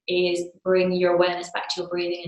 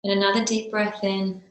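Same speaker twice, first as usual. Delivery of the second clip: slow meditation voice with long pauses; guided meditation. Real, not acted. Teacher settling a class into breath meditation, slow and spaced. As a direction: guide them gently into stillness, phrase by phrase.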